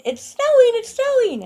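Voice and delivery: high, loud cry of news; imitated excited yelling. Man running into the mall announcing snow, imitated excited yell. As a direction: mimic a man yelling as he runs in. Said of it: "he's yelling".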